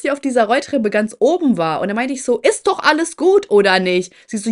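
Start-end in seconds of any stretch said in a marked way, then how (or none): 2.4-4.1 high, loud retold challenge; confrontational. Teller retorting to the girl on the escalator, confrontational. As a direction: throw the question back at her, loudly.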